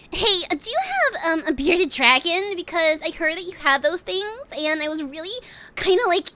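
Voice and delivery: put-on high, eager anime girl voice; Tohru voice. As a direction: ask sweetly like an eager maid. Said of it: "Toru, calling a pet shop".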